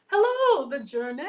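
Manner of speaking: squealing high, loud hello; real thrilled greeting shriek; overexcited hello. Real, not acted. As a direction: burst out with delight at a viewer. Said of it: "[name]'s way too excited".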